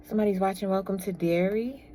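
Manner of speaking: normal talking voice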